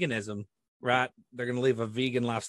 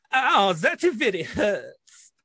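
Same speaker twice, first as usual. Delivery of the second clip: slow, drawn-out, higher mock groan; novelty pencil holder's voice; 5 semitones higher, 4 dB louder, much slower than usual. Imitating a German gift shop pencil holder, mock pained moan. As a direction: moan it slowly like a talking toy in pain. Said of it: "he would go".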